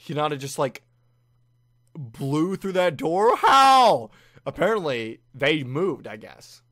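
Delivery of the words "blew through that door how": loud, high disbelieving yell; very loud high how; shocked disbelief. Real, not acted. Reacting in shock.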